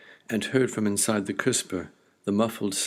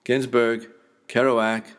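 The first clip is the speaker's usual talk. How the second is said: loud, higher-pitched shout of names; calling out; 3 semitones higher, 7 dB louder than usual. A narrator's reading. Bearded child calling false names at a pizza place, calling out. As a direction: call the names out like hailing someone. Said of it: "a bearded child called".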